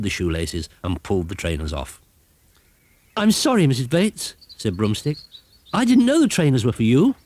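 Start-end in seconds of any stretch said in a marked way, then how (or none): 3.2-7.1 higher, apologetic little broom; a sheepish apology; sorry broomstick. Acted.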